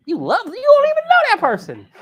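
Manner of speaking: a high, loud "are you serious" reaction; incredulous. Pretend. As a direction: shoot it up in mock disbelief. Incredulous reply to smitten dove.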